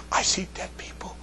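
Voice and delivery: eerie unvoiced whisper; movie whisper. Joking to the class with a famous movie line, whispered eerily. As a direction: breathe it like a spooky movie line.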